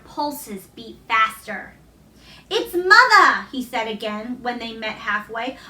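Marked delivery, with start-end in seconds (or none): 2.5-3.5 higher, louder joyful repeat; excited. A narrator's reading. Dickon meeting his mother halfway, excited. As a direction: call it out again with delight.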